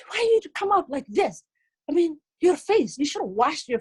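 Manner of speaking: high-pitched voice of a complaining son; embarrassed son. Acted. Son scolding his mother at school, embarrassed and high.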